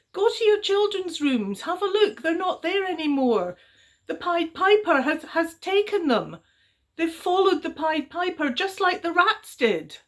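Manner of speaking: high, loud, pressing voice; urgent girl. A narrator's reading. Little girl telling parents the children are gone, urgent. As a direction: urge them to look, breathless worry.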